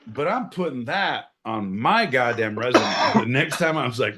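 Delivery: ordinary talk, nothing marked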